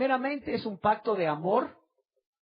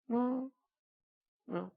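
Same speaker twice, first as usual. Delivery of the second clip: ordinary talk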